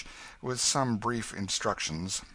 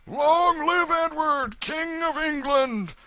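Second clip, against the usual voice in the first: very high, slow, drawn-out shout; thunderous crowd cheer; 8 dB louder, slower than usual. A narrator's reading. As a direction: roar it back like a whole crew. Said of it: "response came with such a thundergust".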